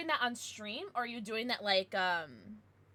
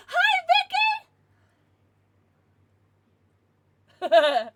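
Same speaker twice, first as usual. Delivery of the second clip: light up at a friend arriving; excited greeting; 13 dB louder, slower than usual. Real, not acted. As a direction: gasp then loud high hi. Greeting a viewer.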